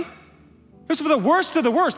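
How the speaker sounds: loud, high, impassioned preaching; preaching at full force. Real, not acted. Preacher pleading to the congregation, full force. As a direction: build to a fervent climax.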